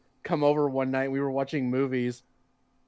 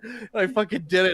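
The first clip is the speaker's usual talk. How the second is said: normal talking voice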